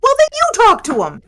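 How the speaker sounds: loud, high-pitched comeback; exasperated retort. Acted. Challenging a companion to address the ghost, exasperated retort.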